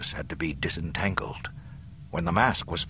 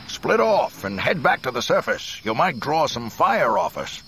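calling out; 6 semitones higher, 6 dB louder than usual. Acted. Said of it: "called Lando".

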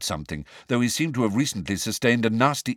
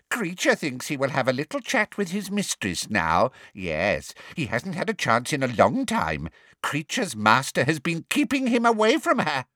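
higher sly elf voice; gloating elf; 6 semitones higher than usual. A narrator's reading. Elf gloats that he'll chat with his mistress, sly. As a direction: gloat in the elf's voice.

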